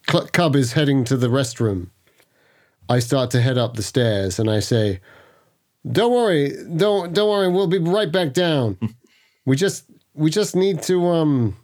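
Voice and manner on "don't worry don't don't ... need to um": higher-pitched, hesitant salesman bluff; high, forced reassurance. Acted. Salesman excuses himself to the guests, high and over-reassuring. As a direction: reassure them brightly while fishing for an excuse.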